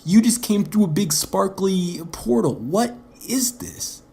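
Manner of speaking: Tony Stark's retort. Retold or imitated. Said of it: "He says".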